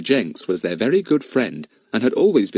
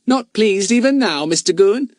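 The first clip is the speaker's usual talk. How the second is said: sweetly; 12 semitones higher, 4 dB louder than usual. Acted. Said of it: "said Fatty sweetly".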